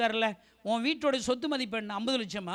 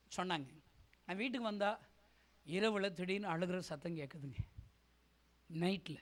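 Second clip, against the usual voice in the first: normal talking voice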